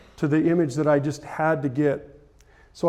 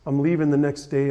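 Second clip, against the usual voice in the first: ordinary talk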